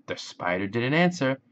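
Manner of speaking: plain reading voice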